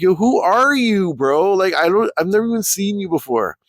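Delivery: retold baffled reply to a stranger. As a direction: act out being confused by a stranger. Retelling his own baffled reply.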